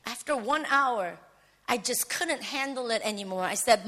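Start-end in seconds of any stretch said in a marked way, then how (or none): none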